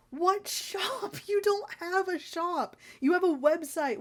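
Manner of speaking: high, disbelieving laugh-scoff; mock the very idea of a shop; incredulous scoff. Real, not acted. Scoffing at a seller who says they have a shop, incredulous.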